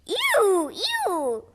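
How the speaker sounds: high squeamish ew; captain squeals in disgust. Acted. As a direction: recoil in disgust. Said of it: "disgusted look".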